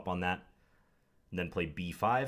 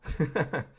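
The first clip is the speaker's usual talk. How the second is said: high-pitched burst of laughter; 9 semitones higher, 4 dB louder than usual. Real, not acted. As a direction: laugh briefly, pitched well up. Opponent replied instantly.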